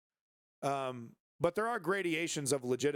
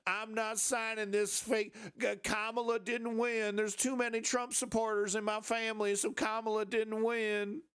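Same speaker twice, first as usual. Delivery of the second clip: mock election denier; 6 semitones higher than usual. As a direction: whine it like a stubborn denier. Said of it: "you don't get to just say".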